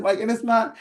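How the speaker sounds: usual speaking voice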